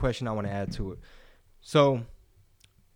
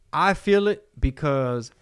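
ordinary talk

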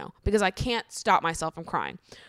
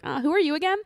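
high-pitched mock puzzled stranger; clueless stranger; 10 semitones higher, faster than usual. Stranger at the wake to the granddaughter, cluelessly nosy. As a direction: imitate an oblivious guest, pitched way up.

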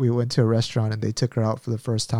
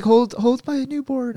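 higher, slow eager voice; friend offering baby; 11 semitones higher than usual. Retold or imitated. Imitating his friend asking him to hold her newborn. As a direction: hand the baby over brightly. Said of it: "She was like".

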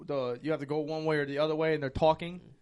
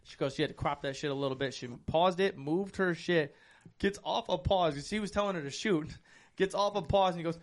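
normal talking voice

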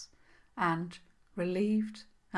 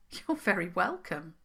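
ordinary delivery

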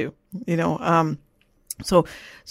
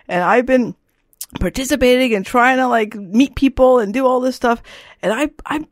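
imitating upset daughter; 6 semitones higher, 4 dB louder than usual. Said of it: "she's like".

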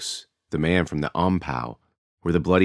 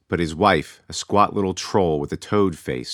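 ordinary talk

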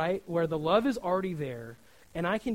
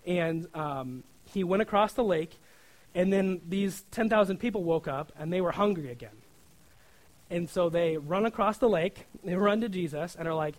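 normal talking voice

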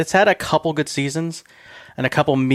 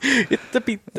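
slow, higher, hammed-up Italian pizza-ghost voice; mock Italian accent; 10 semitones higher, slower than usual. Clowning for the podcast audience in a slow mock Italian accent. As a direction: draw it out like a cartoon Italian ghost. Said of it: "your Italian pizza making ghost accent".

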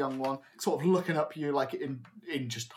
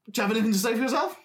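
higher, very fast questioning; stern ringmaster; 6 semitones higher, 4 dB louder, much faster than usual. Acted. Old man looks down at the culprit, quick stern demand. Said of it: "It's like".

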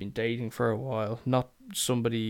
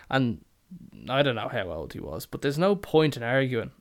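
normal talking voice

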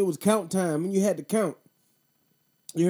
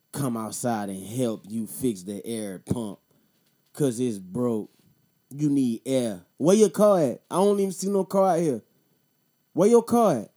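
customer voice; 5 semitones lower, 3 dB louder than usual. Retold or imitated. Mimicking a customer begging help with the air pump.

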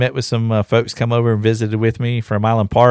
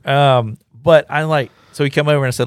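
normal talking voice

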